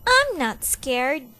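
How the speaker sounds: put-on boy grumping that he is not scared; grumpy. A narrator's reading. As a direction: sulk and insist. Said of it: "Sydney grumped".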